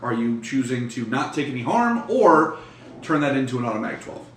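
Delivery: ordinary talk, nothing marked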